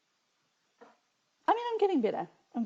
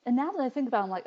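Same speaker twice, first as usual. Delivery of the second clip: ordinary talk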